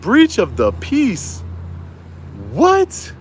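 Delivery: drawn-out repeat, then a high squeak of "What?"; incredulous. Retold or imitated. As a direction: echo the charge back in stunned disbelief. Disbelief at added charge.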